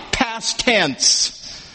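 ordinary delivery

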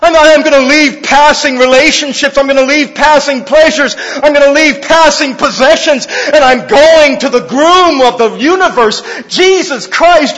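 high, loud, ringing declaration; full-force preaching. Acted. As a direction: proclaim it to the whole church.

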